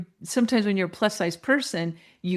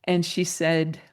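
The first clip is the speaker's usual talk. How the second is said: normal talking voice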